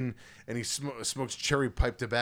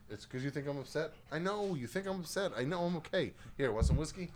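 higher, softer pet-talk; reassuring the dog; 3 semitones higher, 7 dB quieter than usual. Real, not acted. Owner reassures his dog he is not upset, soft and higher. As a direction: gently tell the dog all is fine.

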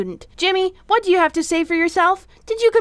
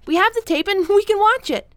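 ordinary talk

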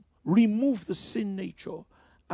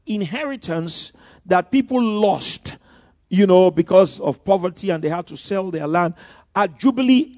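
louder, rising preacher's emphasis; preaching; 9 dB louder than usual. Acted. Preacher to congregation about Jubilee, loud preaching. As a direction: preach it out to the room.

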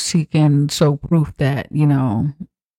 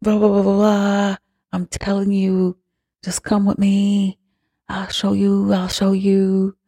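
higher, coaxing voice of an eager convert; a higher, wheedling mock sales pitch; pushy spiritual lady; 6 semitones higher than usual. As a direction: sell it like a pushy believer. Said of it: "So then she was like".

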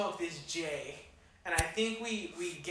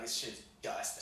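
low, breathy mock whisper of a friend; hushed quote; 8 semitones lower, 8 dB quieter, slower than usual. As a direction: mutter it like a secret verdict. Friend's verdict on the weed.

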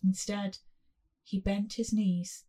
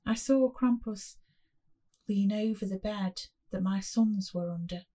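plain reading voice

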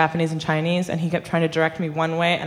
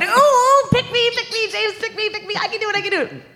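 much higher, eager pleading; retold eager plea, much higher; eager pick me. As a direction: beg like a kid with a hand up. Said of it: "My hand went up".

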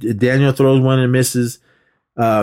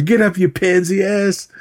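high-pitched mock yell; retold yell; 8 semitones higher than usual. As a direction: imitate a jeering shout, pitched up. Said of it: "So he's screaming".

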